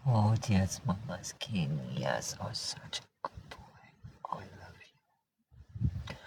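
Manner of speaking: very soft, low, breathy cooing; tender murmur. Real, not acted. Owner cuddling her cat, hushed and loving. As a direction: murmur it slowly and low, nearly whispering.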